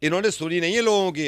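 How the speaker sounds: usual speaking voice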